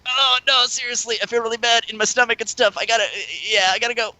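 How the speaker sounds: high-pitched, hurried fake-sick excuse; feeble excuse. Faking sickness to escape.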